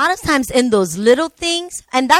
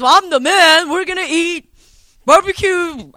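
higher-pitched, loud mock macho declaration; mock bossy husband; 5 semitones higher, 5 dB louder than usual. Wife imitating what her husband never says, mock bossy. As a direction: play a husband laying down the law, exaggerated. Mimics a domineering husband she disowns.

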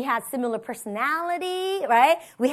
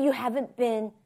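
normal talking voice

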